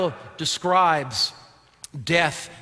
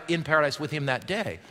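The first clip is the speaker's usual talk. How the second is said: usual speaking voice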